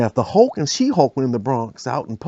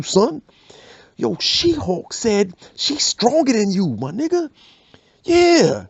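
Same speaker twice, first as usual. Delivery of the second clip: high, pushy instigator stirring drama; hype man; 8 semitones higher than usual. Acted. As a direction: wind Hulk up, much higher pitch. Instigator hyping Hulk.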